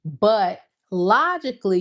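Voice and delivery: ordinary talk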